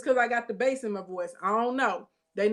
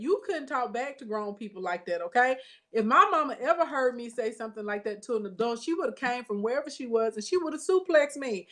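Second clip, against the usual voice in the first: ordinary delivery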